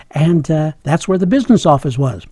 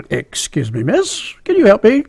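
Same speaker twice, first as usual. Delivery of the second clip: debonair best voice; 4 semitones higher than usual. Retold or imitated. Young applicant trying to impress the office lady, mock debonair. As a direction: lay on the hammed-up debonair charm. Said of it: "put on my best debonair attitude here and my best voice".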